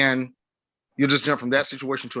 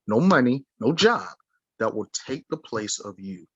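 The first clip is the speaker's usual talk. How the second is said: usual speaking voice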